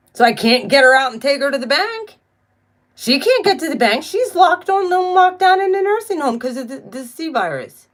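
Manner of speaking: high, worked-up exasperation; exasperated venting. Real, not acted. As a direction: voice climbing high in frustration. Venting about lockdown blocking her rent.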